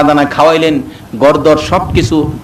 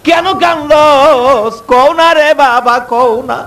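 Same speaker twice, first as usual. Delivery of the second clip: pleading mother; 7 semitones higher, slower than usual. Retold or imitated. Mother pleading with crying son.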